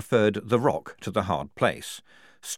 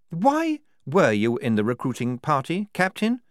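higher, crisp cross-examiner; questioning major; 8 semitones higher, 4 dB louder than usual. Acted. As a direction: put the question pointedly.

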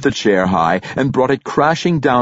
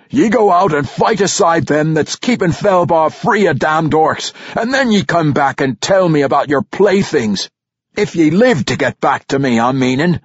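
higher, louder gruff royal command; stern dwarf king; 8 semitones higher, 3 dB louder than usual. A narrator's reading. King ordering Brunner out to fight the orcs, stern and loud. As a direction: bark out the orders like a hard old king.

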